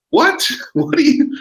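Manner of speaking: squeaky high, laughing mock shock; incredulous laughing squeal at a silly post; shocked disbelief. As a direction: leap up into a laughing what. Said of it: "And I was like".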